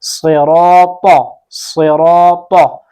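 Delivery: सिराता with a full, heavy, rounded "raa" and "ta"; slow tajweed demo of सिराता; heavy letters. Shown as a demonstration. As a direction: draw out सिराता slowly with thick emphatic consonants. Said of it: "दौद को किस तरह मोटा पढ़ना है".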